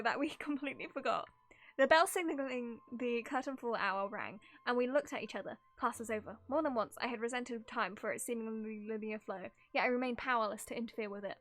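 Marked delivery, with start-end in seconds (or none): none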